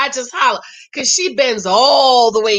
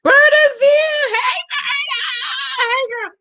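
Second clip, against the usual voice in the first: excited calling out; slower than usual. Real, not acted. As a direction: call out to Berta in delight. Calling to Berta.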